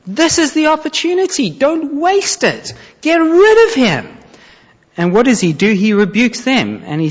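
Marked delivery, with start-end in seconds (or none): 0.1-4.1 much higher, pleading with David not to waste it; frantic men hissing at their leader; exasperated urging. Acted. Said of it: "are pulling their hair out".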